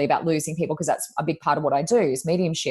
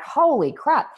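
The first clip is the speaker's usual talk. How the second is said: high, stunned exclamation, half acted; shocked gasp; 8 semitones higher, slower than usual. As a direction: retell her shock, pitched well up. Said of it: "So she was like".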